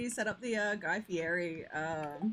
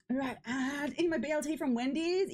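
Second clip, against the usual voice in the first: ordinary talk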